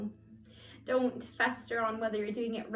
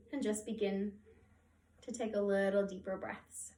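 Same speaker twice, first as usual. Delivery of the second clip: soft, hushed meditation voice with long pauses; guided meditation; 3 semitones lower, 5 dB quieter than usual. Acted. Yoga teacher guiding listeners to track their breath, soothing meditation voice.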